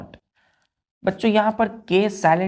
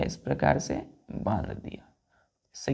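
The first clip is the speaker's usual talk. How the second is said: whisper; 13 semitones lower, 6 dB quieter than usual. Real, not acted. Demonstrating tying rope to class.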